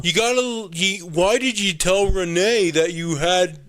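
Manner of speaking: lightly voiced laid-back surfer; a hint of surfer bro; surfer dude voice. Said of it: "he talks like a surfer, bro".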